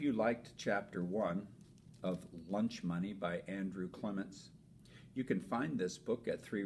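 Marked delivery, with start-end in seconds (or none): none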